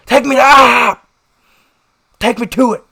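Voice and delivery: high, loud mimicked demand; imitated insistent yelling; screaming. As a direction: holler it like the man in the road. Re-enacting the screaming man again.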